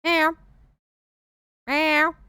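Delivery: high, loud meowing cries for help; cat crying help. Acted. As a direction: scream help like a desperate cat. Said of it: "I am Thor".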